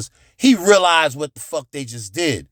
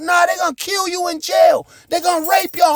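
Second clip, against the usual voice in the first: screaming threats; 11 semitones higher than usual. Retold or imitated. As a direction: yell it back to scare them. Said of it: "I'm saying all this crazy shit, screaming".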